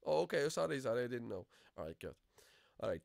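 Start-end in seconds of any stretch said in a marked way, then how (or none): none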